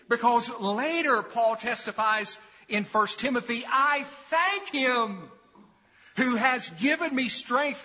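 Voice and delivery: high, loud, ringing pulpit declamation; forceful preaching. Real, not acted. Preacher quotes Paul's thanks to congregation, fervent forceful preaching. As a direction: proclaim the testimony with fervor.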